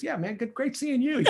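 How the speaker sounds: smooth it over like a pro; pretending to remember Bill; A-Rod breezy. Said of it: "And he goes".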